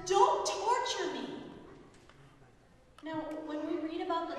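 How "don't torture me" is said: higher, louder, slow cry of "don't torture me"; the possessed man yelling at Jesus; loud pleading shout, drawn out slow. Acted. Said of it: "he's shouting at Jesus".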